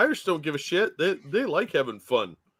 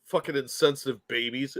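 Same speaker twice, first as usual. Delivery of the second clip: usual speaking voice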